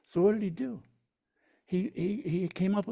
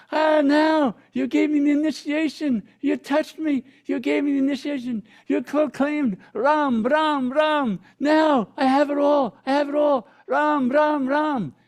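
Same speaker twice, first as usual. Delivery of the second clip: loud, high, jubilant shouting; ecstatic shout; 11 semitones higher, 9 dB louder than usual. Retold or imitated. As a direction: burst with triumph at getting the mantra. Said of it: "Kabir jumps up and goes".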